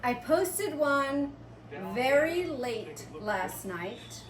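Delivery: usual speaking voice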